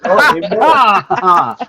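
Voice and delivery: delighted. Real, not acted. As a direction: savour it with delight. Delighted reaction.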